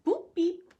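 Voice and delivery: high chirpy beep-boop; droid beeps. Acted. R2-D2 answering with chirpy beeping noises. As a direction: chirp it like a little robot. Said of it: "R two D two says".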